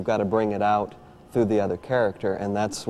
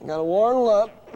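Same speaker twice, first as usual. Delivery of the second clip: puppet voice; 11 semitones higher, 5 dB louder than usual. Acted. Puppet warms up.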